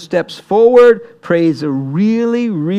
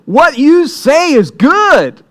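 loud, high, drawn-out shout of approval; crowd's hearty agreement; 9 semitones higher, 7 dB louder, slower than usual. Acted. The people answering Elijah's challenge, loud confident agreement.

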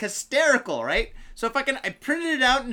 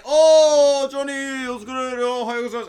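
loud, higher-pitched acted-out hello; hearty greeting; 4 semitones higher, 7 dB louder, much slower than usual. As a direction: call out a big greeting to a buddy. Acting out bartender's loud greeting.

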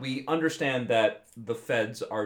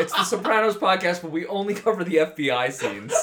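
laughing; 5 semitones higher, 5 dB louder than usual. Real, not acted. Joking about a feds podcast.

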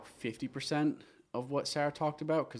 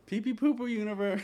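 laughing; 7 semitones higher than usual. Pretend. Echo, higher, laughing.

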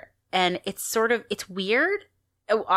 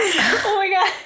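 much higher, louder laughing outburst; 12 semitones higher, 5 dB louder than usual. Real, not acted. Listener apologizing for cracking up at a grisly story, laughing. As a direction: blurt it through helpless laughter. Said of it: "Apologize for laughing".

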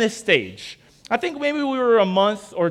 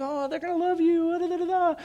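higher, eager gushing; excited; 9 semitones higher than usual. Retold or imitated. Telling girlfriend his family will love her, eager gushing. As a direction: gush about your family. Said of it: "And I'm excited".

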